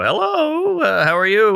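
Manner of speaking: mock-polite high hello, trying to sound sober; best phone voice. Drunk teen calling his mom, mock-polite phone voice. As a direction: put on your nicest phone voice for mom. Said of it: "So I get my best phone voice".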